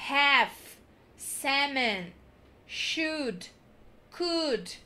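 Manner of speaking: ordinary delivery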